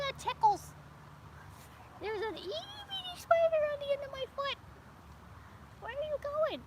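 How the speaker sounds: high sing-song cooing at a bug. Real, not acted. Talking to a tiny spider on her foot, high cooing baby talk. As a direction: coo at it like a tiny pet.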